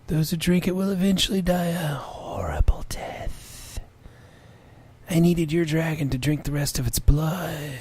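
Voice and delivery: slow, grave creature voice; solemn griffin. Acted. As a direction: intone it like an old oracle. Reads griffin lines in voice.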